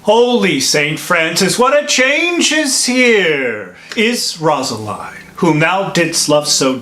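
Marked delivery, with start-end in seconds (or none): 0.0-3.8 astonished exclamation. Acted. Friar to young lover who changed sweethearts, astonished. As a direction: pitch leaping up in amazement. Friar hears Rosaline is forsaken.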